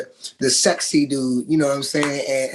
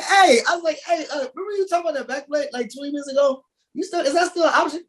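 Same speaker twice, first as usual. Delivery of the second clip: sheepish plea; 11 semitones higher, faster than usual. Retold or imitated. Retold plea for back plate.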